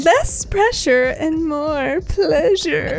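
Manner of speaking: very slow seductive purr; sexy voice. Shown as a demonstration. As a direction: draw out pleasure. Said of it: "sexy ad voice".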